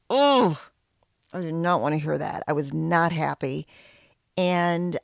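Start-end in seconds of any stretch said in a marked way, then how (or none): 0.1-0.6 high, loud exasperated oh. Real, not acted. Wife reacting to husband's suggestion, loud exasperated oh. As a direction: burst out at the unwanted idea.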